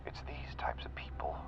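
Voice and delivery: intimate, predatory murmur; hushed menace. Acted. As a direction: confide it quietly, sinking into a whisper. Quietly reading his victim's weakness.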